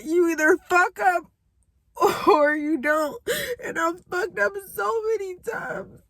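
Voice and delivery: tearful laugh, high and cracking; crying laugh. Real, not acted. Admitting she messed up many times, laughing while crying. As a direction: laugh through the tears. Crying over her mistakes.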